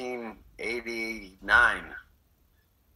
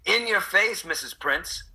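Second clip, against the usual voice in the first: gloating; 8 semitones higher, slower than usual. Pretend. Gloating at old teacher.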